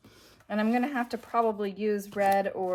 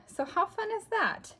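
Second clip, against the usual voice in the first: delighted; 11 semitones higher than usual. Real, not acted. Delighted at old book.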